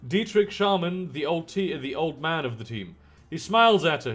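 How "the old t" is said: ordinary delivery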